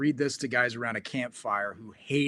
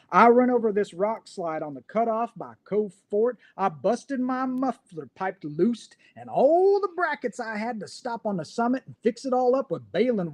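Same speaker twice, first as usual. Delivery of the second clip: a lightly put-on rural Utah brogue, pitched higher; 4 semitones higher than usual. Reading a pig-farming poem aloud to his co-host, in a put-on Utah brogue. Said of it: "read it in the best brogue that I can".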